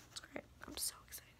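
breathy hushed squeal of delight, almost silent; excited whisper. Pretend. Vlogger hushes her joy over the Starbucks mug, whispered. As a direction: whisper it like a secret thrill.

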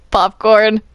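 normal talking voice

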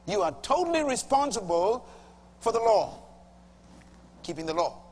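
normal talking voice

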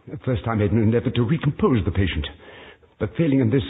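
ordinary delivery, nothing marked

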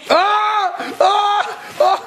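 high yelps of fright; mock scream. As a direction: scream at the mask. Scared by wrestling mask.

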